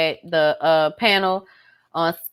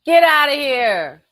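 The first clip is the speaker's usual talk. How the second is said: mock dismissive; 6 semitones higher, slower than usual.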